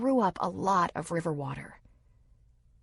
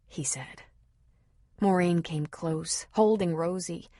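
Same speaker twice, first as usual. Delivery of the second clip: usual speaking voice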